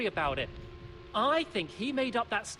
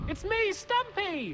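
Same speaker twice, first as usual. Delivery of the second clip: forced cheer; 8 semitones higher, slower than usual. Acted. Forced cheerful self-introduction.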